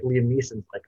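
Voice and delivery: normal talking voice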